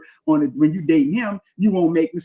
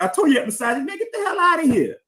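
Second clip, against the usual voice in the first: much higher mocking voice of an accuser; mocking impression; 10 semitones higher than usual. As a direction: parody the accuser, high and scornful. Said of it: "you see that?".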